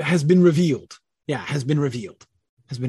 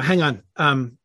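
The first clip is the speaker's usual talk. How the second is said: normal talking voice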